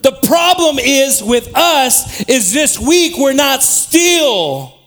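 loud, slow, ringing scripture reading; preaching at full force. Acted. Preacher reading Psalms to the congregation, loud and slow. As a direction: proclaim it to the whole room, slowly.

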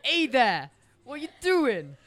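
loud, put-on New Yorker; New York accent. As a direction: greet like a hammed-up New Yorker. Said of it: "a New Yorker".

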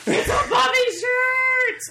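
high, loud shriek of delight; excited shout. Real, not acted. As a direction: shout it out, thrilled by the gift. Unwrapping favorite show shirt.

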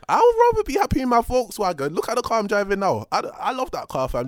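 loud, high playful insistence; excited. Real, not acted. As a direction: proclaim it with delight. Playful loud defense of own car.

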